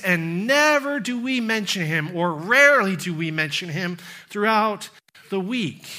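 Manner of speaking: earnest preacher, rising and falling; sermon delivery. Acted. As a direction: preach it to the congregation.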